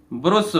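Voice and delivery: "ब्रुश" with a short rounded u; mistaken u vowel. Shown as a demonstration. Teacher reading an example, "ब्रुश" with rounded u instead of uh. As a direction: say "ब्रुश" with u as in put. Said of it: "क्योंकि ब्रुश नहीं बोलते हैं".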